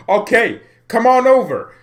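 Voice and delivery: high, loud, gleeful cry of welcome; excited shout. Pretend. Manager on a pretend call, shouting the player over in glee. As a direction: yell it like the deal is done. Gleefully invites Memphis to Bayern.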